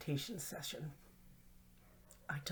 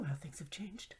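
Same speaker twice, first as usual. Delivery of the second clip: ordinary delivery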